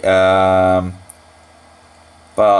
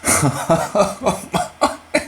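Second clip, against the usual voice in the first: high burst of laughter; startled laugh; 10 semitones higher, 3 dB quieter than usual. Real, not acted. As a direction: laugh in shock. Laughs after eating a bug.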